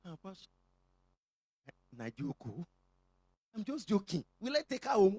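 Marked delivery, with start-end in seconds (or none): none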